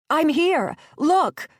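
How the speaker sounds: high, loud desperate scream. A narrator's reading. Gabby screaming at the raft, high and loud. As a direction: scream until your throat burns. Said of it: "She screamed until her throat burned".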